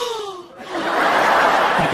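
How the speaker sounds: a high, laughing gasp of amazement; mock gasp. Stranger hearing of Chico's tacos, mock gasp. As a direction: gasp like a stunned out-of-towner. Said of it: "And they're like".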